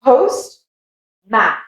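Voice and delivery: Post, long gap, man with full A; separate words. Shown as a demonstration. Teacher splitting postman for learners, separate words. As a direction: Post and man each stressed alone. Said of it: "Give them as two separate words".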